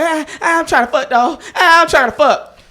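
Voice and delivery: high pleading friend voice; whiny friend. Retold or imitated. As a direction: beg in a high whine. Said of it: "He was like".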